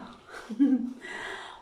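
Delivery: short airy laugh; a quick laugh at herself. Real, not acted. Laughs at her own fuss.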